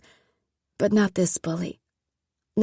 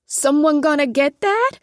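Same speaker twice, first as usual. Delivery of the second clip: high, loud call from upstairs; yelling; 7 semitones higher, 6 dB louder than usual. Acted. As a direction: shout it up through the house. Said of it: "Mama yelled from upstairs".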